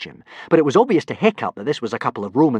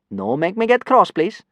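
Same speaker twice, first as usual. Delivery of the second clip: higher, mock-polite warning; 8 semitones higher than usual. Kidnapper warning the class in broken speech. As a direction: threaten them politely. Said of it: "warned the first kidnapper".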